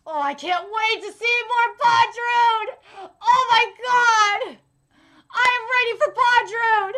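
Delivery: loud, high and slowed fangirl squeal; real ecstatic excitement. Real, not acted. Fan gushing about seeing villain Bondrewd, loud ecstatic squeal. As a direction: lose it with joy over your favourite.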